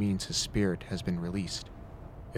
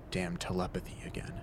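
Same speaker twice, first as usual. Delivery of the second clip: ordinary delivery, nothing marked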